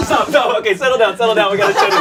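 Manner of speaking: normal talking voice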